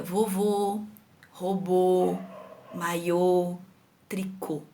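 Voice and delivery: final ô held closed and low in vovô, robô, maiô, tricô; word by word, a rounded closed "ô" at each end; closed circumflex vowel. Shown as a demonstration. Teacher showing pupils the circumflex, closed-vowel demonstration. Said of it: "som, a boquinha mais fechada".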